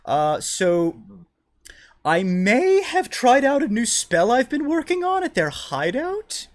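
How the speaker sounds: high, loud, drawn-out character voice; slow high-voiced woman owning up; wizard woman confessing. Game master voices the wizard woman.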